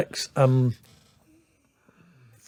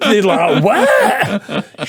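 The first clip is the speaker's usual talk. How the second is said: laughing as he retells their disbelief; 4 semitones higher, 6 dB louder, much slower than usual. Real, not acted. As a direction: quote spoken through laughter. Laughing through the retold reaction.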